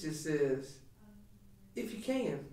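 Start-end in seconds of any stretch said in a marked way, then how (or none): 1.7-2.4 higher, dry, half-laughing throwback; lightly sarcastic echo; sarcastic retort. Retold or imitated. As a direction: toss the words back with a mocking edge. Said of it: "is he saying it sarcastically".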